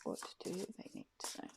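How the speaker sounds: voiceless whisper of the question; whispered ask. Real, not acted. Asking the tarot cards for a message, whispered.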